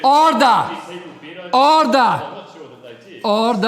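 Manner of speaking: loud, rising shouts of order; shouting order order. Real, not acted. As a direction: bellow for order over the noise. Chair shouting order over chaos.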